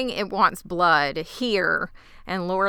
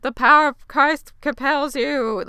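higher, louder hammed-up exorcism cry; mock exorcist scream; 8 semitones higher, 7 dB louder than usual. [name] play-acts The Exorcist at Lottie, mock scream. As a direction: imitate a zealous exorcist shouting. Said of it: "because she's screaming that".

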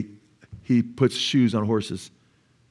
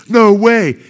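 much louder, high-pitched disbelief; amazed exclamation; 10 semitones higher, 11 dB louder than usual. Retold or imitated. Preacher retells receiving a handmade gift, amazed exclamation. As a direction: exclaim it, reliving the astonishment.

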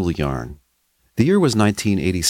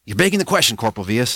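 high, snappy comeback; sharp retort; 6 semitones higher, much faster than usual. Acted. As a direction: fire it back quickly. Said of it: "shot back Speedo".

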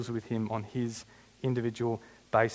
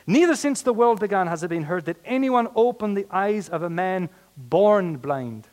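high, loud dramatic argument; emphatic declamation; 6 semitones higher, 7 dB louder than usual. Acted. Healed man argues from the law, loud declamation. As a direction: proclaim it forcefully, slowing on the last words.

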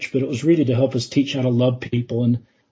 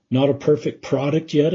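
ordinary delivery

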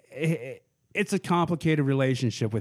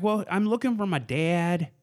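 higher-pitched imitated Southern accent; 5 semitones higher than usual. Girl messaging a possible father, put-on Southern accent. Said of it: "I don't know where the accent came from because she's not Southern".

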